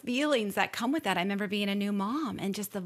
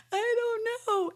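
whiny reluctance; 13 semitones higher than usual. Retold or imitated. Still resisting husband's encouragement.

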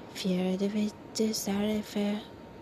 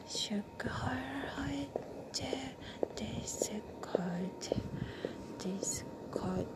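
soft unvoiced whispered narration; whispered story reading; 9 dB quieter, slower than usual. Reading a novel aloud to a listener, whispered. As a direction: hush it right down.

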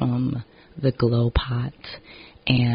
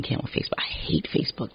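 breathy whispered grumble; soft whispered gripe; 8 dB quieter, faster than usual. Real, not acted. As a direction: drop it to a whisper. Trails off in a whisper.

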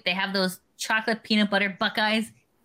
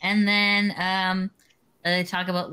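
ordinary delivery

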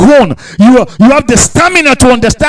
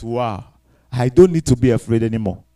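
low, hushed acted wonder; softly voiced dawning relief; quiet realization; 10 semitones lower, 7 dB quieter than usual. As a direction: let it sink in softly. Acts servant's relieved realization.

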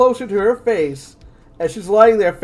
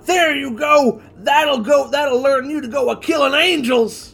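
sputtering foiled villain; 6 semitones higher than usual. Acted. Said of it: "It's like shaking his fist".

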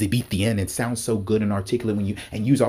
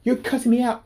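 shocked disbelief, pushed further; 12 semitones higher than usual. Retold or imitated. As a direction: say it again, more outraged. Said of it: "I'm like".

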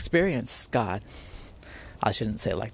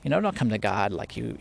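ordinary talk

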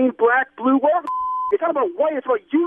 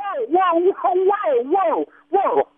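alarmed whoa; 7 semitones higher, much slower than usual. Real, not acted. Learns caller wants rid of body.

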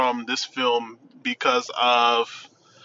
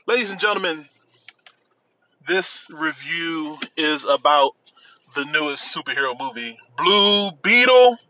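announcer. Pretend. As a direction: present it like a ringmaster. Announcing the reviewed film.

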